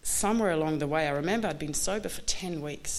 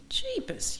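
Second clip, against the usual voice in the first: sudden high-pitched jeepers. Real, not acted. Dreading God's help, a high startled jeepers. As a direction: squeak it out in alarm.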